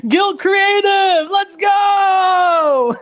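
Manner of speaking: high, drawn-out hype yell; excited shout. Real, not acted. Host hailing Guild Creative in the chat, excited shout. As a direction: belt it out like a hype man.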